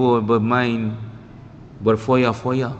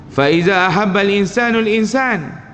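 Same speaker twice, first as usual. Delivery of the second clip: Arabic recitation; 7 semitones higher, 4 dB louder than usual. Acted. Quotes an Arabic text.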